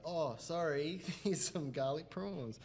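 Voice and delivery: soft, mildly sheepish retold apology; quiet, awkward imitation of an apology; cringey apology. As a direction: offer it meekly, slightly cringing. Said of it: "cringily, like, be like".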